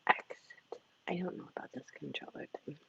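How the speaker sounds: fast hushed whisper; mutter-whisper while fiddling. Real, not acted. Host checking texts and controller, fast whisper.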